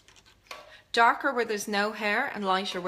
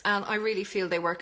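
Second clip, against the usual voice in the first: ordinary talk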